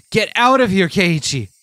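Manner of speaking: high, loud cry to get away; a boy yelling at himself to flee; panicked yell. Acted. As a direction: shout it out while running for your life. Character yells in panic.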